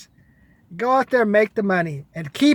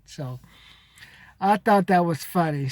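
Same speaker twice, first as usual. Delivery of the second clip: ordinary talk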